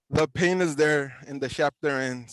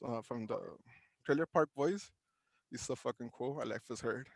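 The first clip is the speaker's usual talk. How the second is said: quiet aside; 3 semitones lower, 10 dB quieter, faster than usual. Real, not acted. Aside recalling own writing.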